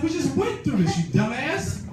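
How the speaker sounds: high-pitched fed-up outburst; acted-out scolding of a hopeless friend; exasperated. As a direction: voice leaping up in comic frustration. Exasperated retort to stuttering friend.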